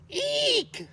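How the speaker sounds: very high, loud shriek of alarm; high scared eek; shrill eek. Acted. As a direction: squeal in shock. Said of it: "she cried".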